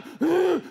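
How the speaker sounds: high, sharp mock intake of breath; imitated startled gasp. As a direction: gasp like someone jolting upright. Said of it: "you can see him sitting up".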